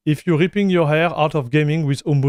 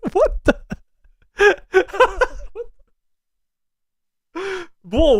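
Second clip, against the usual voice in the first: high-pitched, incredulous laughing; 13 semitones higher than usual. Real, not acted. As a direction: crack up mid-phrase, voice shooting up. Cracks up at chat's mayonnaise message.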